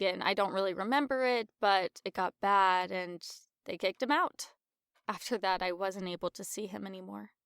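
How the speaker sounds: low, wistful confiding; subdued reminiscence. Acted. Confiding in a friend about lost grandfather, subdued. Recalls losing touch with grandfather.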